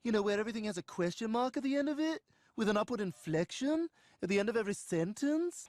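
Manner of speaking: fast, every phrase rising like a question; mock a girlfriend's rising questioning tone; upward inflection. Shown as a demonstration. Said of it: "talk like this".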